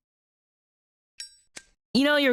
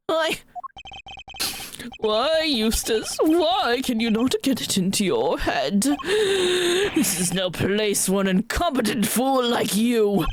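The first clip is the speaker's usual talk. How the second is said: tearful scolding; 5 semitones higher than usual. Acted. Father berates son, crying.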